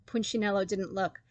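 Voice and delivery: ordinary delivery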